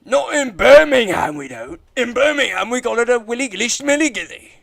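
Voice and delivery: loud, high, hammed-up Brummie voice; mock British accent. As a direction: shout it like a rowdy Brummie. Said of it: "that's not a British accent you keep doing".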